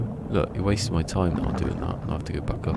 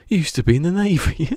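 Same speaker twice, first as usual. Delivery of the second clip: higher, louder mock indignation; a hammed-up pitch-raised jab; Dale's retort; 11 semitones higher, 5 dB louder than usual. Telling a joke, Dale needles Albert, higher and louder. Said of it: "He goes".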